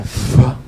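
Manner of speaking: "Vá" as a low puff of air; breath-like sound. Shown as a demonstration. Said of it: "uma respiração e não".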